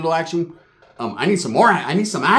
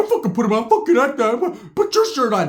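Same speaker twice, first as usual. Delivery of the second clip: higher defiant mock voice; Ricky mocked; 6 semitones higher than usual. Ricky mocks the shirt line, high and defiant. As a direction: toss it back high and cocky. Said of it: "Ricky's like".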